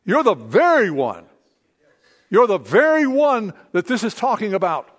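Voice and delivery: loud, driving sermon delivery; preaching at full force. Acted. Pastor urging the congregation, loud full-force preaching. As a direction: preach it with conviction to the room.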